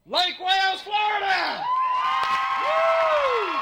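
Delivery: climbing up to a high whooping cheer; hyped shout-out. Real, not acted. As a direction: sell the town name like a big arena call. Hyping the crowd, calling out.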